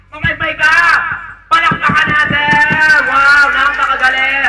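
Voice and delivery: loud, high, drawn-out call for applause; excited showman cheer. Real, not acted. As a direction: whip up the crowd's applause. Showman cheers the successful trick. (masiglang sigaw na pampalakpak)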